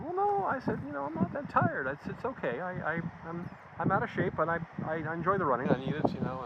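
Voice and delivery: squeaky feigned humility; high mock-innocent voice. Foreigner answering the chief's teasing in a high put-on voice. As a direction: pitch it way up, falsely modest. His own reply retold, much higher.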